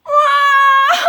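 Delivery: very loud, high mock wail; little girl crying. A narrator's reading. Little girl cries in the squeaky bed, loud mock wail. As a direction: burst into a pulsing storybook bawl. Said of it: "cried the little girl".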